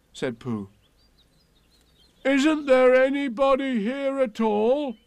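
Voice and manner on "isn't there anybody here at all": much higher, louder, slower call. Acted. Pooh calls into the hole, loud high call.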